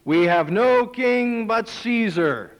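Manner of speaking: defiant declaration. Acted. Chief priests answering Pilate, slow and defiant. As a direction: spell it out slowly and defiantly.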